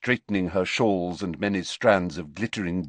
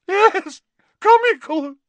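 shrieking, much higher and louder; hysterical; 11 dB louder, slower than usual. A narrator's reading. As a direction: indignation boiling over. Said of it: "Her voice rose rather hysterically".

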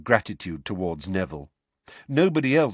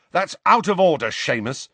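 stern rebuke; 7 semitones higher, 5 dB louder than usual. A narrator's reading. Ron angrily rebuking Seamus.

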